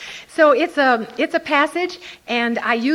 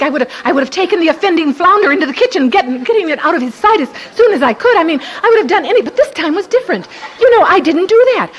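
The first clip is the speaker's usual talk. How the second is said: fast excited telling; 7 semitones higher, 8 dB louder, much faster than usual. Real, not acted. Lively retelling.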